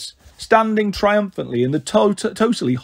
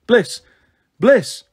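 raised, higher searching call; 5 semitones higher, 4 dB louder than usual. A narrator's reading. Lily calling her missing sister, raised higher call. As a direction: call out for a lost child.

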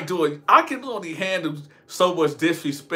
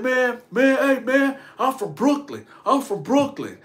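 higher-pitched mock Brooklyn boast; mildly raised, posturing tough-guy voice; imitated hollering; 8 semitones higher than usual. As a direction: puff up and holler like a street braggart. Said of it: "he keep hollering all this".

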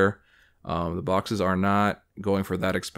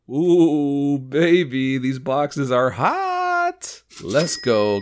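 louder, slow savoring of a hit; excited ooh; 5 semitones higher, 4 dB louder, much slower than usual. Real, not acted. Collector thrilled by his box pulls, excited. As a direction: relish the pull out loud. Hot card pull reaction.

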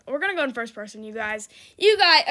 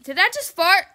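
ordinary talk, nothing marked

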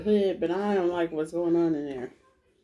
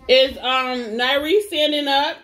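loud, higher question called to another room; calling out question; 7 semitones higher, 9 dB louder, slower than usual. Real, not acted. Mom calling across the house to ask about her baby, loud and high. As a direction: call out to check on the little one.

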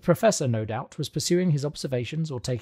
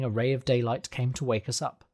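plain reading voice

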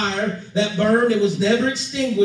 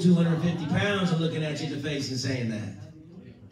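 ordinary talk, nothing marked

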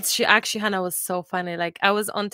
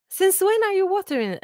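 higher, louder mock-startled question; retold dad caught off guard, pitched up; mock surprised dad; 10 semitones higher, 3 dB louder than usual. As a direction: play the startled dad catching her out. Retelling husband's surprised question.

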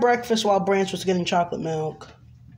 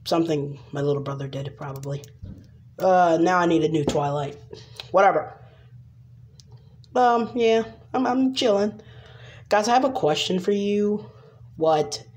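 ordinary delivery

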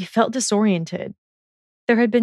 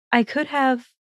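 put-on a higher girl's voice, hesitant; Hermione's voice; 6 semitones higher than usual. Hermione cornered by Draco, a higher hesitant voice.

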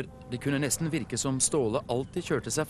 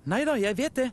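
high-pitched little know-it-all; high, hasty character reply; impatient brush-off; 12 semitones higher, 3 dB louder, faster than usual. A narrator's reading. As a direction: wave the instruction away eagerly. Theodor impatiently brushing off instructions.

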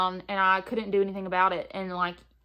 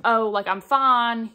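slow, louder mock-cheery brush-off, "fine" stretched; fake fine; 8 dB louder, much slower than usual. Telling viewers how she fronts, slow louder fake-cheery "fine". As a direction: put on a front that everything's okay. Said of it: "fronting and being like".

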